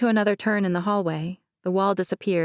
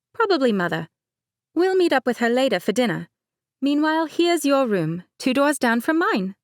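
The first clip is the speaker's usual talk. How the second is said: light higher-pitched bride, laughing it off; Mercedes voice; 7 semitones higher, 3 dB louder than usual. Acted. Bride Mercedes teasing her cousin, higher light voice. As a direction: play the bride breezily.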